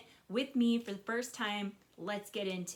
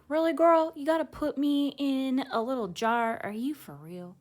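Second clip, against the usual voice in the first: high, louder indignant little critter voice; annoyed butterfly; 6 semitones higher, 9 dB louder than usual. Acted. Butterfly in the jar complaining to Rocket, sassy and higher. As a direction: voice the butterfly sassing the girl. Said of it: "It's like".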